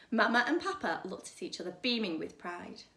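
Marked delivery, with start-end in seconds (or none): none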